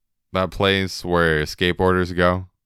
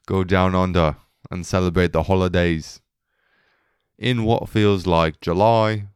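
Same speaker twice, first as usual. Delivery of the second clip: put-on Australian accent. Rich folks heading down under for the holidays, mock Australian accent. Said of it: "great Australian accent".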